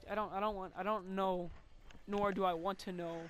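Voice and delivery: normal talking voice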